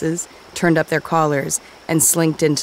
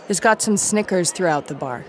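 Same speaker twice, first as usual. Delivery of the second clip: usual speaking voice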